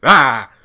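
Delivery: short, loud "ah"; worked-up yell. Real, not acted. Host flustered by the Daikon scene, exclaiming. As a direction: burst out loudly, lost for words. Wordless outburst over the scene.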